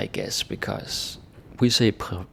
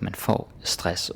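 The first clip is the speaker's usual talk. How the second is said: slow example; slower than usual. Shown as a demonstration. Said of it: "you say just the noun".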